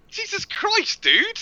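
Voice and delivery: high incredulous jesus christ, dude; exclaiming. Real, not acted. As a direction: throw up your hands in disbelief.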